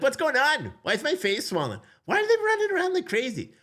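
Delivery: put-on high, small child's voice asking; confused little kid. Dad voicing his scared son.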